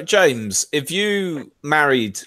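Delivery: ordinary delivery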